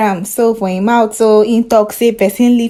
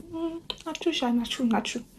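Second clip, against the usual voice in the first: much softer, higher murmur; soft agreement; 6 semitones higher, 13 dB quieter than usual. Acted. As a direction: murmur agreement under breath. Friend agreeing.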